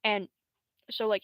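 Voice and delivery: normal talking voice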